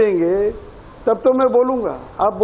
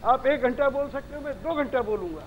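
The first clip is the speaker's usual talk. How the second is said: real protest, much softer in the mix; volume turned down; 11 dB quieter than usual. Real, not acted. Guest arguing with the anchor for time to talk, volume turned down. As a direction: argue on with the microphone faded down. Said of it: "Now reduce his volume".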